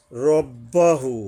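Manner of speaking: রব্বাহু chanted slowly with the ending held; stretched recitation. Shown as a demonstration. Teacher recites রব্বাহু for students, slow chant. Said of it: "একালিপ টানতে হবে".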